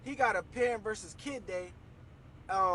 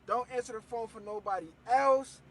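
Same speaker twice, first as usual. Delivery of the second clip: usual speaking voice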